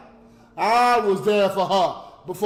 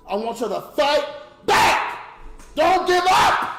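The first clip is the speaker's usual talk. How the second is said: louder and much higher, yelling to fight back; rallying shout; 9 semitones higher, 7 dB louder than usual. Real, not acted. Urging the audience never to quit, shouting. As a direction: fire the crowd up. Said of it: "motivational shout".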